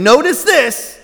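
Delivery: "Notice this" pitched high, falling at the end; emphatic. Acted. Preacher voicing Jesus flagging a truth, emphatic "Notice this". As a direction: lift "Notice this" like an exclamation point. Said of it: "putting the exclamation point on it".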